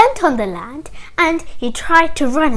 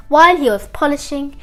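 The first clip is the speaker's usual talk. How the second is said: usual speaking voice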